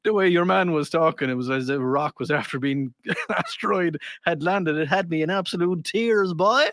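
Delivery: amused Irish brogue; Irish lilt, tickled by the story; Irish accent. Acted. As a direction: read it out as an Irish lad. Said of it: "in your accent".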